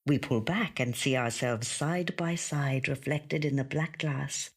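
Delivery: ordinary delivery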